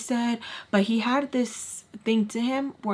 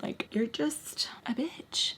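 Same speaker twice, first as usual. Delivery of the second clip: whispering; 3 semitones higher, 7 dB quieter, slower than usual. Retold or imitated. Muttered insult at the ex.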